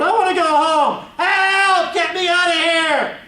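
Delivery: high, loud cry for help; blubbering wail. A narrator's reading. Trapped child cries for rescue, blubbering and yelling. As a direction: bawl it out in panic. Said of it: "I blubbered".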